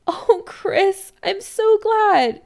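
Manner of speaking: high, drawn-out motherly gushing; bright delight. A narrator's reading. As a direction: gush with happy relief. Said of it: "She laughs brightly".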